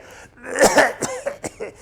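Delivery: a weak, rattling patient's cough; imitated sick cough. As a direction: cough like a man with pneumonia. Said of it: "He's talking like".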